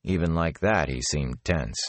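plain reading voice

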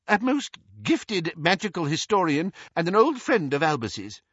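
high, insistent praise; cling to this defence of a friend; eager old man, pitched high; desperate eagerness. A narrator's reading. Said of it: "clutching at Harry's question like a drowning man".

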